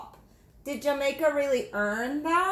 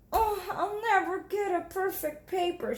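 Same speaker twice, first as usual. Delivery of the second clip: put-on higher, tearful little girl voice; almost in tears; mild crying girl; 7 semitones higher than usual. Jamaica despairing during the test, near tears.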